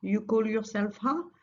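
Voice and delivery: ordinary talk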